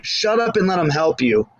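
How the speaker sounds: ordinary talk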